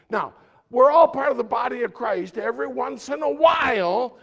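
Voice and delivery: loud, high preacher shouting; full-force preaching shout; fiery preaching. Real, not acted.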